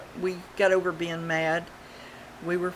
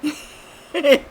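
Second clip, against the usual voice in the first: high, loud drawn-out name like a meow; the cat yowling the name; cat's holler; 9 semitones higher, 8 dB louder than usual. Retold or imitated. Cat on the porch rail calling the name, loud and high. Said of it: "she goes".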